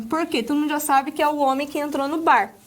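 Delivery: usual speaking voice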